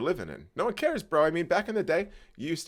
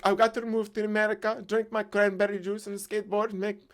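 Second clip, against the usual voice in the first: higher, broken-English voice of a hopeful immigrant; dreamy mock accent, higher throughout; foreign accent; 10 semitones higher than usual. Mocking people dreaming of America in a put-on foreign accent.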